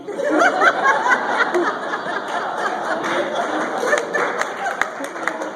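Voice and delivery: loud high burst of laughter; big laugh. Real, not acted. Comedian cracking up at his own joke, loud laugh. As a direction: laugh hard at your own punchline.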